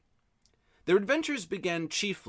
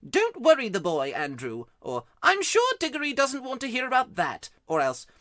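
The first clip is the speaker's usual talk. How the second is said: lightly voiced fussing aunt, a touch louder; Aunt Letty; 11 semitones higher, 6 dB louder than usual. Diggory imitates Aunt Letty hushing his uncle, lightly voiced. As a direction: mimic the aunt shushing. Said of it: "She says".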